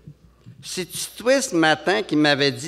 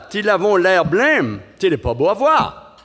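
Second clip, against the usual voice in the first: slow, higher, louder mock old-timer; put-on character voice; 5 semitones higher, 5 dB louder than usual. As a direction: croak it out like a crusty elder. Opening bit in put-on voice.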